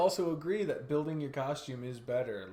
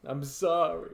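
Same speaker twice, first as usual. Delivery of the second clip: normal talking voice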